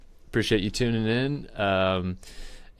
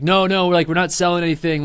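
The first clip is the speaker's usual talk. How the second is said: higher, louder firm refusal; shop staff refusing; 6 semitones higher, 5 dB louder than usual. Retold or imitated. Skate shop staff turning away the line, loud refusal. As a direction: turn the crowd away. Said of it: "they're like".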